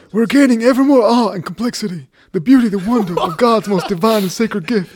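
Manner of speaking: exaggerated American accent reading a presidential quote. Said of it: "I could do mine in an American accent".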